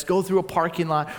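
normal talking voice

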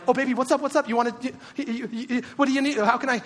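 high, stammering fussing over a crying wife; flustered fixer husband. Retold or imitated. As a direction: imitate an overeager husband scrambling to help. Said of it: "Anytime my wife's crying, I'm like".

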